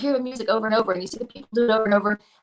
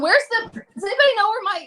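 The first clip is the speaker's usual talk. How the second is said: flustered searching; 10 semitones higher, 4 dB louder than usual. Retold or imitated. Frantic search for lost glove.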